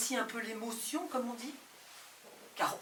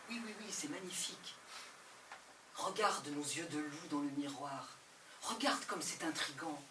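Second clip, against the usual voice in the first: tender softness; 5 semitones lower, 4 dB quieter than usual. Acted. Lying beside a lover, softly tender. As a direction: coax them gently to look.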